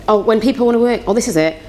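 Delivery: normal talking voice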